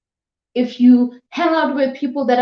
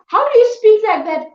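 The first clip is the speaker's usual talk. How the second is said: indignant rebuke; 9 semitones higher, 4 dB louder than usual. Acted. Rebuking the self-pitying voice.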